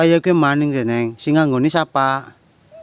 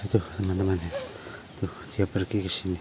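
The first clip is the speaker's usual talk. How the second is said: low, much softer murmur to the camera; hushed aside; 8 semitones lower, 13 dB quieter, faster than usual. Real, not acted. To viewers, watching him leave: hushed murmur. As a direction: keep it down so he won't notice.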